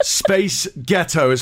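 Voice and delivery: slow, exaggerated American accent; demonstrating an American accent, slowly. Shown as a demonstration. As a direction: stretch it out in American. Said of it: "Say it in an American accent".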